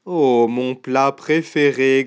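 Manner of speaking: slow gruffalo growl, relishing; growling monster. Acted. Gruffalo spotting his favourite dish, slow greedy growl. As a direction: growl it slowly, savouring the find. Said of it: "grogna le gruffalo".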